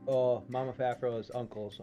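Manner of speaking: ordinary talk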